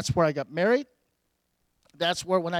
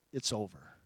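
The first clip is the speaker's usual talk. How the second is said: hushed, gentle divine voice, lower; soft voice of God; 8 semitones lower, 11 dB quieter than usual. Retold or imitated. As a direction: speak as God, softly and low. God's words quoted.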